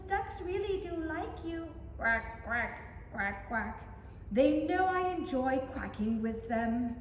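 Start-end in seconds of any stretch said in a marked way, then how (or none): none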